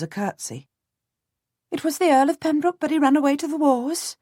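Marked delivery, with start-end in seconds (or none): 1.7-4.2 put-on high, quick voice of a flustered peasant girl; a startled servant girl piping up to a lord.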